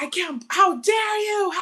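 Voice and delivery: high, loud gasping how-dare-you; mock outrage. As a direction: ham up the shocked victim. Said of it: "I'd be like".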